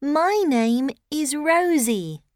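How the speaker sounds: put-on high child voice; bright little girl; young girl voice. Child character introduces herself.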